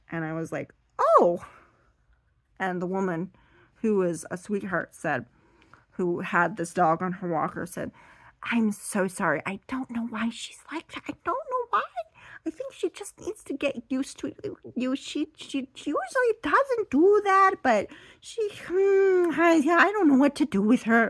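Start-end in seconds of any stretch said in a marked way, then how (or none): 1.0-1.4 loud, high startled oh; acted jolt of surprise. Reacting to teeth on skin. 8.4-21.1 high, fretful mock apology; imitated woman's voice climbing high; apologetic dog owner.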